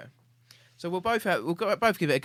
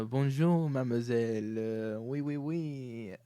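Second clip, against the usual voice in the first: a light put-on French accent, "Bonjour, mademoiselle"; hamming up a Frenchman for a guessing game; mock French accent; slower than usual. Host does a mock French accent for his guest.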